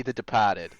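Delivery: Boston "De-PAH-ted", r dropped; natural Boston pronunciation of "Departed"; Boston accent. Shown as a demonstration. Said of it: "you're allowed to do that accent".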